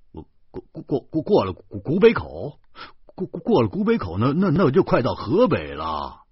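loud, high, halting stammer; flustered stutter. A narrator's reading. Astonished driver repeats the route, stammering badly. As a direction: trip over each phrase, then burst through it.

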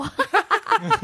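sudden high laugh that falls away; burst of laughter. Real, not acted. Telling friends about the crying coworker, a high falling laugh. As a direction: crack up at the memory.

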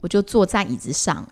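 normal talking voice

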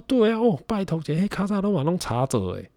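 high auntie voice in Taiwanese; chatty old shop auntie; shopkeeper auntie. Retold or imitated. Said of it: "他说".

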